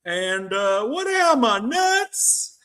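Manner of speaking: high put-on voice of a blunt TV advisor; Doctor Phil impression. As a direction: lampoon a TV doctor telling someone off. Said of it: "Doctor Phil's words of wisdom, folks".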